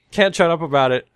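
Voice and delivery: usual speaking voice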